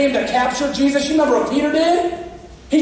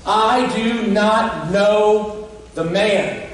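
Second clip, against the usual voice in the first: slow denial; much slower than usual. Acted. Peter's third denial, with a curse.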